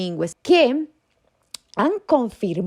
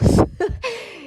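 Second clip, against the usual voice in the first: amused laugh. Real, not acted. Laugh at myth.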